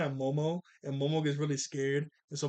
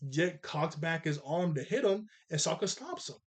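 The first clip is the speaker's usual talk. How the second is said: ordinary delivery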